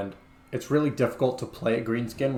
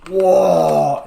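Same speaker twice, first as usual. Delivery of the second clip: loud orc bellow Waaagh; all caps shout; 7 semitones higher, 11 dB louder than usual. Shown as a demonstration. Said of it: "is always in all caps".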